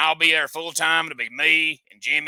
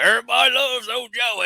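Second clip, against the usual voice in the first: a hint of a smug Joey, higher; mocking Joey; 8 semitones higher than usual. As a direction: tease him with a puffed-up voice. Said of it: "you're all arrogant".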